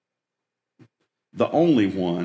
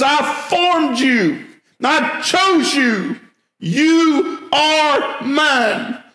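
slow, higher, each phrase hammered; emphatic declaration; 5 dB louder, much slower than usual. Acted. Pastor voicing God's claim to his people, slow emphatic declaration. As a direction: declare it as God's own word. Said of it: "he says".

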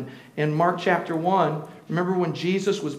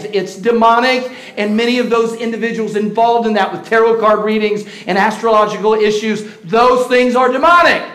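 loud, raised warning sermon; forceful preaching; 5 semitones higher, 8 dB louder than usual. Acted. Preacher warning the church about psychics, forceful preaching. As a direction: warn them hard against the occult.

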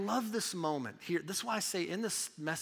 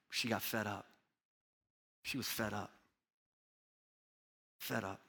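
hushed; 7 semitones lower, 7 dB quieter than usual. Acted. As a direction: let the words sink, barely above a breath. Drops voice for effect.

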